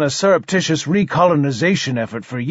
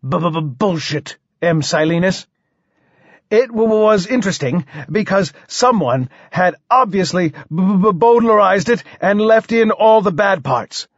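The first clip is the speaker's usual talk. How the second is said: stammered rebuttal rising to a higher voice; stuttering king; 5 semitones higher than usual. Acted. King dismisses the poet's polite thanks, stammering. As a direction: contradict the flattery through a stutter.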